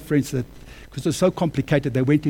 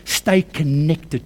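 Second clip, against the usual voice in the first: slow emphasis; much slower than usual. Shown as a demonstration. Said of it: "the Lord told me".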